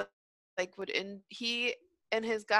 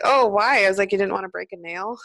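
mocking; 10 dB louder than usual.

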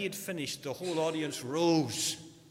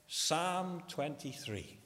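ordinary talk, nothing marked

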